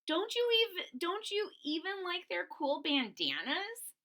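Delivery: very high, wheedling question; coaxing Stella. Acted. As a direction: tempt your friend with the cool bandanas. Friend Stella voiced.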